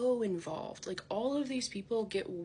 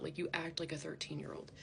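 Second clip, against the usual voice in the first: normal talking voice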